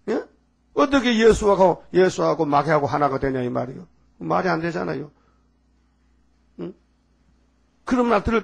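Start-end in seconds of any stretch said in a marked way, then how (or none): none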